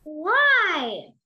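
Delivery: insistent little questioner; questioning child. A narrator's reading. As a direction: pipe up like a nagging child. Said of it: "nearly all the children's remarks".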